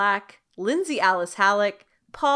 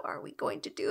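whispered; 10 dB quieter, faster than usual. Acted. Teasing next episode.

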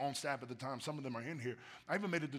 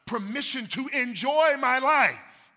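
louder, higher, slower proclamation; preaching shout; 10 semitones higher, 14 dB louder, slower than usual. Real, not acted. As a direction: proclaim it with full force. Preaching climax.

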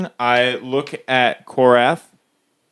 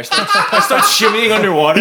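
high, laughing through the words; 8 semitones higher, 5 dB louder than usual. Real, not acted. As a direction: giggle it out. Laughing while describing.

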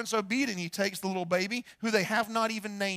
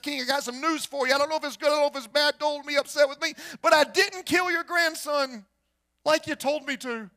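servant blurting out awkward news, pitch raised; anxious confession; 4 semitones higher, 6 dB louder than usual. Acted. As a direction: build louder as you confess to the king. Servant nervously confesses to the king.

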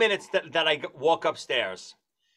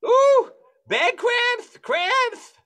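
high, loud wail of cramp pain; hammed-up yelps, drawn out and high; mock screaming; 7 dB louder, much slower than usual. Houseguest crying out over toe cramps, mock screaming. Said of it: "he's screaming".

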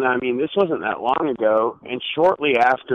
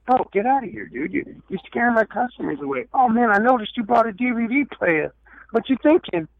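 high-pitched comic hustler voice; awkward yard-sale skit; 11 semitones higher than usual. Acted. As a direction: act out the skit in a raised, put-on voice. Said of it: "imagine the awkward situation trying to tell the guy to leave".

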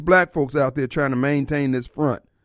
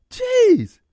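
dismayed exclamation; 5 dB louder than usual. Real, not acted. Dismay after depressing video.

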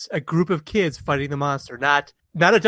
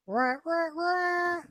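high, held wailing sax notes; imitated saxophone riff; 8 dB quieter, much slower than usual. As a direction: mimic a saxophone with your voice. Sorry, saxophone guy.

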